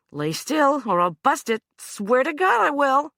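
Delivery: high, loud, snarling warning; fierce threat. Acted. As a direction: threaten him hard while pinning him down. Anna pinning Hugh, threatening.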